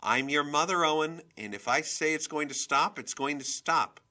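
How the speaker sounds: mother putting her foot down; angry authority. A narrator's reading. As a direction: pull rank on the child, loudly. Mother asserts authority over son.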